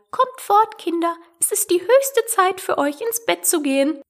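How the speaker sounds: high, anxious canary voice; trembling chirp. A narrator's reading. Canary calling its children away from danger, trembling. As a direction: pipe nervously, hurrying little ones away. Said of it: "piepte zitternd zu seinen Kleinen".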